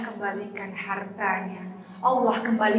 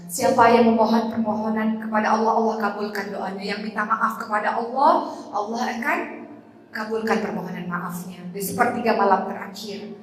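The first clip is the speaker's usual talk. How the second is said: ordinary delivery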